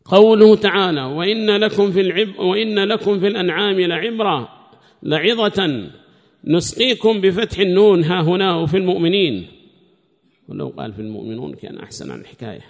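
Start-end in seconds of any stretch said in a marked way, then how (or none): 0.0-9.5 loud, high-pitched recitation of a book; formal text reading. Acted. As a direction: read the text out loudly to the class. Reads the commentary text aloud.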